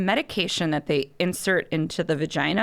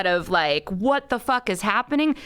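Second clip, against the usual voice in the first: retold outburst; 6 semitones higher than usual. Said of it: "I literally lost my mind".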